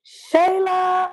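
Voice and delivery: high excited call of a name; happy shout across the room. Real, not acted. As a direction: call her name out with delight. Greeting viewer who joins live.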